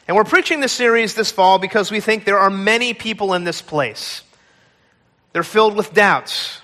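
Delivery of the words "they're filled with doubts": ordinary talk, nothing marked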